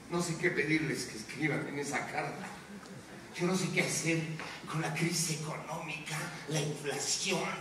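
low, soft admission; subdued helplessness. Acted. Anchor admitting he has no answers, subdued. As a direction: drop low and quiet, at a loss. Admits not knowing what to do.

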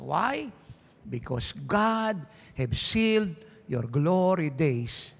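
very slow, weighty pulpit proclamation; slow prophetic declaration. Real, not acted. Preacher declaring blessing over the congregation, slow and weighty. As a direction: spell out each word like a prophecy.

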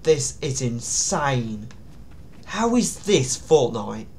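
ordinary talk, nothing marked

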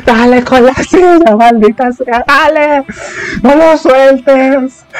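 high, egging-on fan voice; high mock cheer, drawn out at the end; pretend cheering. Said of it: "Yo estaré ahí como".

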